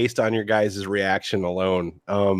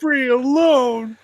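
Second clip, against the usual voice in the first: high, slow, wailing plea; high wailing parody; much slower than usual. Retold or imitated. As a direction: wail it in a high voice. Chris Crocker parody.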